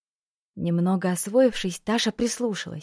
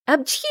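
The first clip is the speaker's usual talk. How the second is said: sneeze; 6 semitones higher than usual. A narrator's reading. Tasha sneezes in dusty temple.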